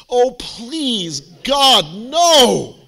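loud, high exasperated plea; mock horror. Speaker reacting to students choosing Shakespeare, loud mock horror. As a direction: cry out in exaggerated dread.

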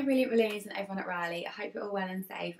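usual speaking voice

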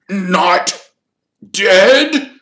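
high, loud, strangled disbelief; choked. A narrator's reading. Distraught caretaker hearing his cat is alive, choked. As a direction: choke the words out one at a time. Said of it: "choked Filch".

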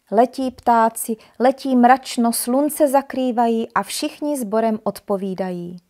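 ordinary delivery, nothing marked

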